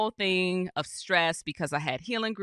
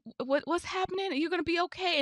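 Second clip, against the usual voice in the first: imitating a friend's anxious questions, much higher; stumbling pitched-up mock worry; 10 semitones higher, 3 dB quieter, much faster than usual. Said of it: "like, you know".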